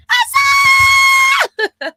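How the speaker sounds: long high shout of a name; excited shout. Real, not acted. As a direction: shout out a friend's name, drawn out. Greeting a viewer by name.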